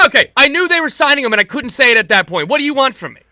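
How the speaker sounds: high, loud protest at being teased; exasperated self-defense. Real, not acted. Host defends his draft pick to teasing co-host, exasperated. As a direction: plead your case, voice shooting up and loud.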